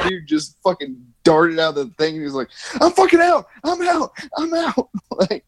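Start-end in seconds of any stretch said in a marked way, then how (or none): 2.8-4.9 high, hammed-up panic, bailing out; mock panicked bail. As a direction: squeal it like a man fleeing the ring. Fighter fleeing the kangaroo.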